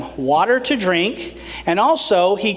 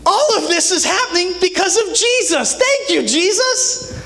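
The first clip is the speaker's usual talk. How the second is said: joyful praise; 12 semitones higher than usual. Acted. Paul praising Jesus.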